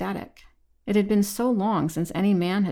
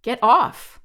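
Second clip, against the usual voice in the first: loud, higher-pitched bark; barked command; 5 semitones higher, 8 dB louder than usual. A narrator's reading. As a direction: bark it out to repel someone. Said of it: "she barked".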